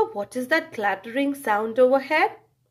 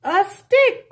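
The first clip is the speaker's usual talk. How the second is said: higher, louder excited cry; girl cries out; 6 semitones higher, 6 dB louder than usual. Acted. Girl at the river cries out eagerly. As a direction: call out like an eager little girl. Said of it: "cries the girl".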